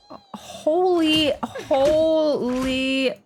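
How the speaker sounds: normal talking voice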